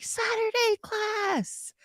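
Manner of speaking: high, slow, stretched-out mock delight; drawn-out squeal imitating keen students; teasing the chat in a high, slow voice; mock eager fan. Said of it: "but you're like".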